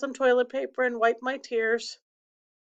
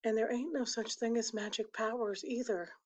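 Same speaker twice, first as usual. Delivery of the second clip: softer, eased-off voice; no longer mean; 9 dB quieter than usual. Acted. Byron easing off on his brother Kenny, softened and less mean. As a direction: drop the meanness and say it much more softly. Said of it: "stopped sounding so mean and said".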